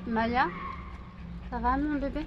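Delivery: high sing-song pet talk. Real, not acted. Speaking to her dog.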